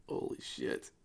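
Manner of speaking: hushed, voiceless exclamation; stunned, quiet. Real, not acted. Game master stunned by the huge map, hushed. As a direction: say it under your breath in shock.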